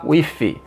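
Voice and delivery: "with" said as "wiffy"; wrong F pronunciation. Shown as a demonstration. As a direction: show the mistake, "with" with an F sound. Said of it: "Parem de falar with".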